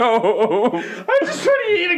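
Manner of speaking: high, slow, loud laughing wail; laugh-shouting whine. Pretend. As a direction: wail it through helpless laughter. Joining the bit, laughing.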